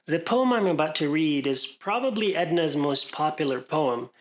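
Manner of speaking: normal talking voice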